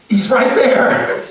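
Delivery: slow, high, loud reveal; dramatic reveal. Acted. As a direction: point him out with big drama. Queen points at Haman.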